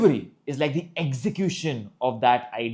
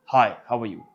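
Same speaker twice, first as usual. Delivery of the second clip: low-pitched greeting; 3 semitones lower than usual. Shown as a demonstration. Coach showing pitch difference, low-pitched. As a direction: drop the hello down low. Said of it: "Tone was the same".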